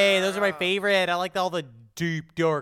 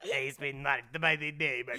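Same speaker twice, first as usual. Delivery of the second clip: low, soft mock French djinn; lowered, put-on French demon voice; Gerard Depardieu; 5 semitones lower, 8 dB quieter than usual. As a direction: drop into a hushed, bad French accent. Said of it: "Is that Gerard Depardieu?".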